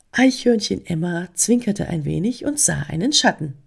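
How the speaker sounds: usual speaking voice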